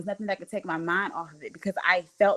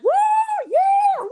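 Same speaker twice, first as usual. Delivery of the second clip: high cheering whoops, much louder; imitating cheering crowd; 8 dB louder than usual. Retelling the hallway cheers, imitating a whooping crowd. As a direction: mimic the crowd cheering her on. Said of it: "Everybody was clapping, like".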